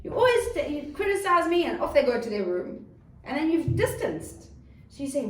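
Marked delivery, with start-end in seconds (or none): 0.0-1.6 high, loud aggrieved outcry; child's protest. Acted. Child accusing a scolding parent, acted protest. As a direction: hurl it back as a wounded child. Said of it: "they'll say".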